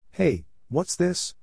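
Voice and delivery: slow, high, dopey Goyle; drawn out, puzzled and slow; slow dim voice. A narrator's reading. Goyle's voice in story.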